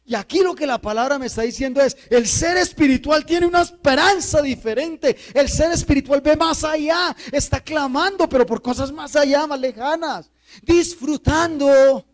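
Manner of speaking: high, loud, driving sermon; impassioned pulpit shout; fervent preaching. Real, not acted. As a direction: preach it at full force, building to a long last word. Preaching at full force.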